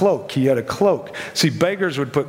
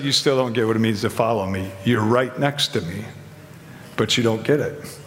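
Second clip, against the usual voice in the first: a hint of Jesus, gently chiding, pitched lower; lightly voiced Jesus; 6 semitones lower than usual. Jesus voiced gently correcting disciples.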